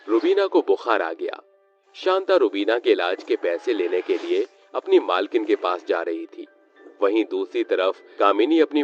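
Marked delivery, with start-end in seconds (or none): none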